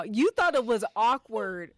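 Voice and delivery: ordinary delivery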